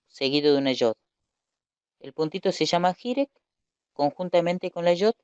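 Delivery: ordinary talk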